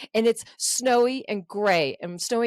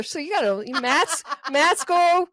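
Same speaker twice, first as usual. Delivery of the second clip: ordinary talk, nothing marked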